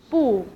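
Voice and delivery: 不 with a falling tone; fourth tone on 不. Shown as a demonstration. Teacher adding negation, falling fourth tone on 不. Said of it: "pronounced as in fourth tone".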